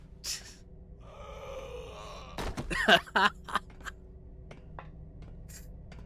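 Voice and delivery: crying with laughter; laughing. Real, not acted. Laughs to tears.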